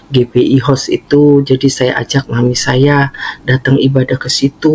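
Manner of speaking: ordinary talk, nothing marked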